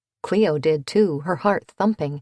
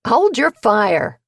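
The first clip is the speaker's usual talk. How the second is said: shouting; 5 semitones higher, 7 dB louder, slower than usual. A narrator's reading. Stranger shouts from thicket.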